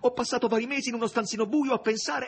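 high, loud, wounded woman pouring out her pain; Margherita's anguished reproach. A narrator's reading. Margherita rebuking the master who sends her away, anguished and loud. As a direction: let it spill out, raw and aching, close to tears.

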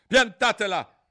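high, loud, exasperated yell; shout. A narrator's reading. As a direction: shout them down as the queen. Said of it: "gridò la regina". (grido esasperato)

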